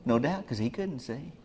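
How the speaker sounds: ordinary delivery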